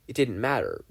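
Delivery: plain reading voice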